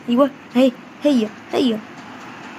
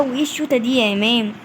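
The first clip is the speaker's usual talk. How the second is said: ordinary talk, nothing marked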